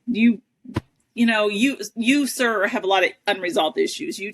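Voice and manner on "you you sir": ordinary talk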